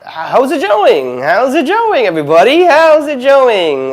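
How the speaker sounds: high, loud, stretched-out hello; goofy vlog greeting. Real, not acted. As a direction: belt out a goofy greeting to viewers. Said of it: "I'm a little goofy when it comes to, update videos".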